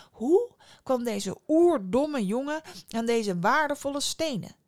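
scornfully baffled; incredulous wondering. A narrator's reading. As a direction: wonder with exaggerated disbelief. Innkeeper puzzles over boy's riches.

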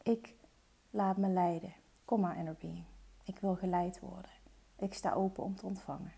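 soft, calm guiding voice; hushed relaxation guide; guided meditation. Acted. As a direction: talk them gently into relaxing. Spoken affirmation in meditation.

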